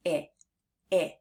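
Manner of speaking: short, relaxed i vowel; short I sound. Shown as a demonstration. Teacher showing kids the short I sound, quick and relaxed. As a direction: say I as a quick lax "ih". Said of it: "open your mouth slightly".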